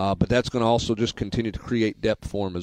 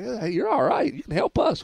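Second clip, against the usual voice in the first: much higher, imitating an excited golf partner; fired-up praise; 11 semitones higher than usual. Host mimics his partner praising his three good holes, excitedly. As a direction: squeal it out like a pumped-up teammate. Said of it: "Mickey was pretty fired up. Like".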